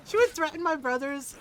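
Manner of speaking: usual speaking voice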